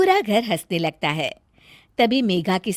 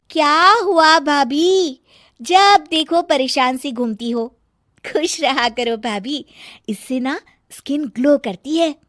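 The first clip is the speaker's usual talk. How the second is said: teasing younger sister; 6 semitones higher, 5 dB louder than usual. Acted. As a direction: tease her sister-in-law sweetly. Sonam teases sister-in-law.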